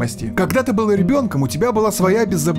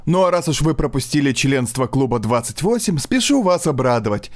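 usual speaking voice

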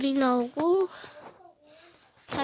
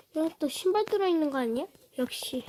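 ordinary delivery

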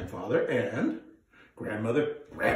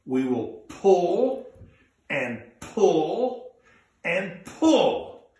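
slow, louder pulls building in height; straining pull; 3 semitones higher, 4 dB louder, much slower than usual. Shown as a demonstration. Storyteller leading kids in a pulling chant. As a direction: tug out each pull with effort. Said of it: "Come on, let's try it".

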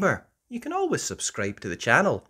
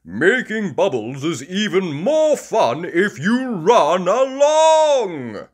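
higher, loud jolly dad voice; Daddy Pig voice; 7 semitones higher, 10 dB louder than usual. Acted. Daddy Pig encouraging the kids, loud and jolly. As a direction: boom it out cheerfully. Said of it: "says Daddy Pig".

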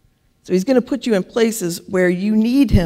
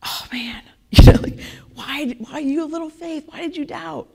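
gentle whisper; 6 semitones higher, 3 dB louder than usual. Retold or imitated. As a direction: gently chide a friend in a whisper. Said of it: "kind of gently saying".

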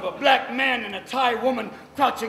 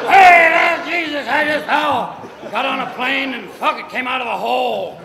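higher and louder, hammed-up panicked rant; mock panicked yell; 5 semitones higher, 12 dB louder than usual. Captured fugitive yelling at soldiers, mock panicked shout. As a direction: yell it like a cornered fugitive caught off guard.

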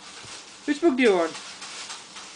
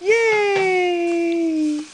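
happy cheer; 10 semitones higher than usual. Real, not acted. Cheering the baby after the story, a high happy yay. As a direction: cheer her on sky-high.

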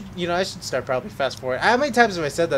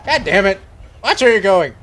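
loud, higher road-rage yell; angry shout; 4 semitones higher, 10 dB louder than usual. Real, not acted. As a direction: bark it at a careless driver. Road rage at game driver.

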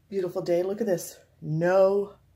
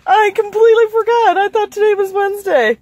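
high, loud, through laughter; laugh-crying confession; 12 semitones higher, 10 dB louder than usual. Real, not acted. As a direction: confess while cracking up.

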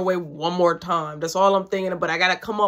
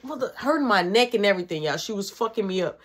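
ordinary talk

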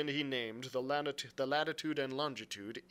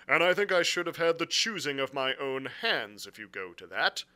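louder, firm captain's voice; blunt sea captain; 4 semitones higher, 9 dB louder than usual. Acted. Captain explaining his orders to the gentlemen, bluntly. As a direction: state the grievance firmly and formally. Captain's dialogue beside narration.

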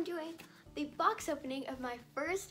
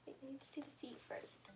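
whisper; 2 semitones higher, 9 dB quieter than usual. Real, not acted. Whispers before unwrapping the feet.

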